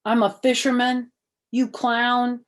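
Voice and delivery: loud snotty retort. Acted. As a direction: sneer at the clown. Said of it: "sucked his cheeks".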